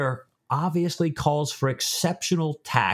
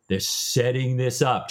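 ordinary talk

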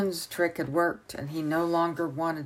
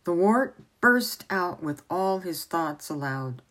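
ordinary delivery, nothing marked

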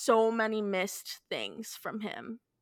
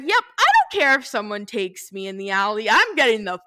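louder, half-laughing declaration; retold exit line; 9 dB louder than usual. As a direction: blurt it out loud with a laugh at the end. Retold storming out of apartment.

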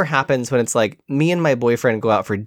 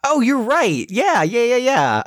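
high, eager yeah yeah; light up as you spot him; excited recognition; 9 semitones higher, slower than usual. Real, not acted. Recognizing the actor on screen.

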